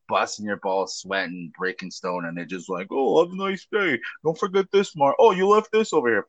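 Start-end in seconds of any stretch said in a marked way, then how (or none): 2.9-6.2 lightly mocking, higher sing-out; oblivious homeowner. Homeowner to sweating workers, lightly mocked breezy higher voice. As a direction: mimic a client breezily ordering workers about.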